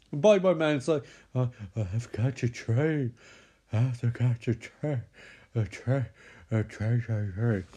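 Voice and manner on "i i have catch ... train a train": lightly voiced movie monster; stammering, repetitive spooky villain; Bye Bye Man. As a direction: trail into repeats like a movie bogeyman.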